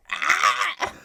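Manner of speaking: sudden high, loud mock scream; imitated scream. Imitating the neighbour once guests leave, a loud mock scream. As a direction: burst into a wild shriek the moment guests leave. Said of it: "it's like".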